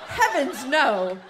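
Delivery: high, loud shocked exclamation; shocked gasp. Acted. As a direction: burst out in dismay. Mom shocked at tax news.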